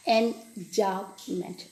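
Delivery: "Enjambment" very slowly, syllable by syllable; correct pronunciation. Shown as a demonstration. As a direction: break "Enjambment" into three drawn-out syllables for learners. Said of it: "That's the correct pronunciation".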